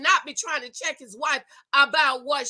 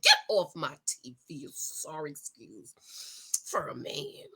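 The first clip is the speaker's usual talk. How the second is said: much lower, much softer, fading to a whisper; contemptuous hush; 10 semitones lower, 8 dB quieter than usual. Real, not acted. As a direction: hiss it out in disgust. Scolding Shaq on TV.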